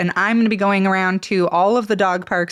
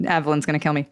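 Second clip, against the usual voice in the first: usual speaking voice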